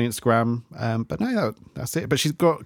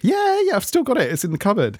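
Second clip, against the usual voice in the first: much higher, light mock diva voice; playful high imitation of the host; imitating her; 11 semitones higher, faster than usual. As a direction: squeak it out like a cheerful diva. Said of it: "she was like".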